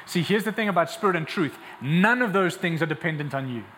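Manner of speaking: normal talking voice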